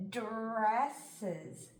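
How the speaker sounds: "Dresses" drawn out slowly, sound by sound. Shown as a demonstration. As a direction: stretch "Dresses" into its separate sounds. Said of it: "try sounding it out".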